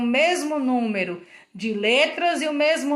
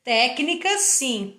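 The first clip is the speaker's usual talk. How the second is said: ordinary delivery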